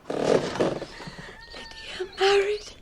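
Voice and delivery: awed whisper, drawn out slowly; breathless whisper. Acted. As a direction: gasp it out in wonder. Mother's gasping delight.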